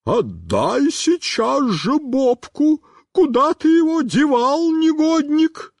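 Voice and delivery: high, slow, shrieking woman's voice; a woman demanding her dog back, shrieking high; inhuman shriek. A narrator's reading. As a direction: shriek at the boy on the fence. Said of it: "нечеловеческим голосом".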